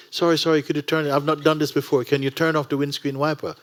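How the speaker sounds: high, loud pleading in a rush; panicky student. Retold or imitated. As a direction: beg the instructor like a frightened learner. Nervous student begs instructor.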